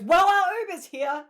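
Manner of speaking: high, loud quick exit line; sharp high excuse. Retold or imitated. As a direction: fire it off to escape. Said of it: "Lily goes".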